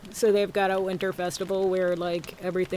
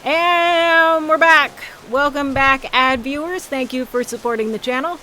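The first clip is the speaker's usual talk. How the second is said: excited announcer; 8 semitones higher, 12 dB louder than usual. Acted. Welcoming viewers back after ads.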